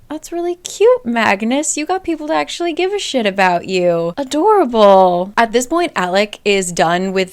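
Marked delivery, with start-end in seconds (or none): 0.1-5.3 high, sneering demon voice, mock-sweet. Demon father mocks his son's friends, sneering and mock-sweet. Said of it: "And the demon is just like".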